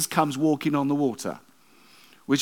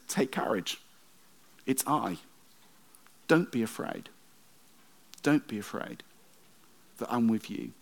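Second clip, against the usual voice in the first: soft, calm words of comfort; Jesus calming terrified disciples, softly reassuring; gentle reassurance; 3 semitones lower, 5 dB quieter than usual. Acted. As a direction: soothe frightened men in a storm.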